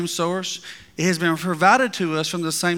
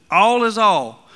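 "All is all" spoken slowly and louder, each word weighted; emphatic slow declaration; 3 semitones higher, 7 dB louder, much slower than usual. Shown as a demonstration. Preacher insisting to congregation, slow and loud. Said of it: "You look up all in the Greek".

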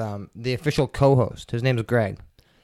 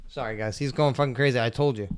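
ordinary talk

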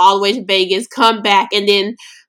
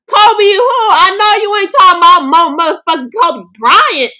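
disbelief; 10 semitones higher, 5 dB louder than usual. Retold or imitated. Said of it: "And I'm like".

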